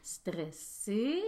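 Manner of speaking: high little sing-song voice; playful minimising. Pretend. Host admits she is a little bit stressed, playful minimising. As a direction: shrink it to a tiny, high "petit peu".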